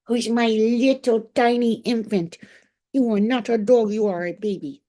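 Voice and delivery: high, loud gushing coo. Real, not acted. Owner calling her dog a baby, loud high gushing. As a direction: gush at a pet as if it were an infant.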